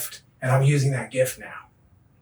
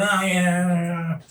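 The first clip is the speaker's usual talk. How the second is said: dismissive brush-off; 4 semitones higher than usual. Retold or imitated. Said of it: "I'm doing one of these, like, sloughing it off".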